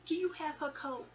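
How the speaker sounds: high-pitched imitation of a mother's question. Mother confronting her daughter on the stairs, sternly questioning.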